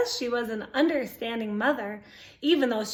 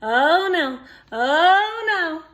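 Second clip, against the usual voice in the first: mock alarm; 3 semitones higher, 4 dB louder than usual. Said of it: "He's like".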